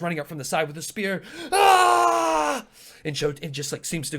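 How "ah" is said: long, high, loud charging yell; battle cry. Acted. Human runs at Chotuku with a spear, long battle scream.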